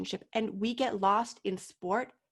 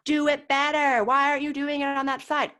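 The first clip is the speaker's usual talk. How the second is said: louder, higher, barking orders; mock coach yelling; 4 semitones higher, 7 dB louder than usual. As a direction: play the harsh coach scolding an injured skater. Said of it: "So then I'm gonna yell at you".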